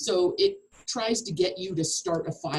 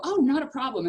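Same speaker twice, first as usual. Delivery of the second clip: client agreeing lightly; 7 semitones higher, 4 dB louder than usual. Retold or imitated. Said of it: "And they go".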